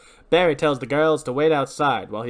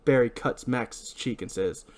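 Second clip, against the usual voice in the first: normal talking voice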